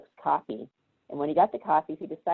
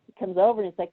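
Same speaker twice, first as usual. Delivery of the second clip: ordinary talk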